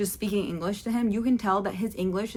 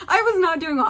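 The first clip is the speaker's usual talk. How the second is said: much higher and louder, mock dramatic; loud emphatic repeat; 10 semitones higher, 8 dB louder than usual. Shown as a demonstration. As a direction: belt it out like a comic lament. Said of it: "repeats the line".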